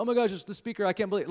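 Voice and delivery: high, loud mock gush; starstruck fan. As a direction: starstruck over the speaker. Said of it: "you're like".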